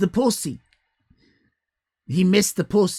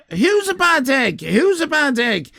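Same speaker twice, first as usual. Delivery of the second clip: mock scolding; 4 semitones higher, 6 dB louder than usual. Teasing a chatter again.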